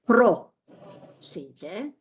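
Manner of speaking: prosíte with PRO punched, a pause, then síte; stress on first syllable. Shown as a demonstration. Teacher showing students where the stress falls, first-syllable stress. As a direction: break prosíte slowly after a stressed PRO. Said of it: "the stress is on".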